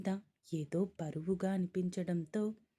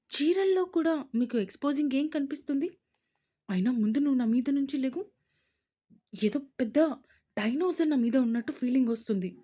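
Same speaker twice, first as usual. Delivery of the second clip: loud, fast exasperated scolding; indignant complaint; 5 semitones higher, 5 dB louder, faster than usual. A narrator's reading. Wife telling husband to get off her, loud indignant. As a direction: tell him off, fed up.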